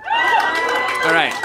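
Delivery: comic surprised by crowd; squeaky all right. Real, not acted. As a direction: yelp it. Crowd response to question.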